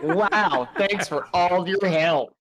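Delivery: slow mock-grateful thanks; put-on sarcasm, drawn out; imitating sarcastic customer. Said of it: "You sound like somebody who, like, tried to return clothes".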